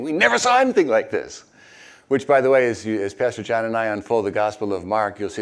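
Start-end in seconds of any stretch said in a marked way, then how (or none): 0.0-1.4 high, loud amazed exclaim; amazed crowd. A narrator's reading. Amazed crowd marvelling at the healing, high and loud. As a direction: cry it out in wonder.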